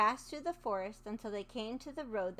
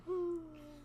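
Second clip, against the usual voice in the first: startled gasp; 6 semitones higher, 3 dB quieter than usual. Acted. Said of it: "she startled to hear".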